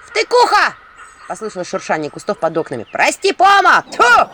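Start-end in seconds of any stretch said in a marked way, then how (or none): 0.1-0.7 high shout from the bushes; sudden high yell; loud outcry. Acted. Voice outside shouts. 2.9-4.3 high loud curse and spit; high shouted curse, then spitting; spitting villager. Acted. Someone outside curses and spits.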